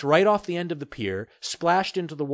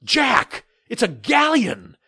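higher, louder and slowed in awe; with wonder; 11 semitones higher, 6 dB louder, slower than usual. Acted. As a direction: breathe it out in slow amazement. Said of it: "my father said with wonder".

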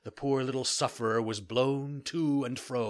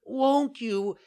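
put-on high, loud, slow pleading boy voice; beseeching; 13 semitones higher, 7 dB louder, slower than usual. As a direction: beg at the door. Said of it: "cried Pinocchio in a beseeching voice".

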